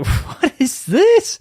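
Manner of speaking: high, slow, drawn out; what is this, said high and slow; mock outrage. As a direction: stretch it in disbelief. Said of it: "I'm like".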